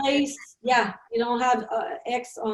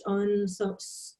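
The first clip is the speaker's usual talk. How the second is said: ordinary talk, nothing marked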